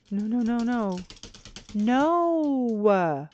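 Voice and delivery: rising alarmed shouts of no; real dismay, loud and high; panicked no. Real, not acted. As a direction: yell it as the picture vanishes. Game deletes his picture.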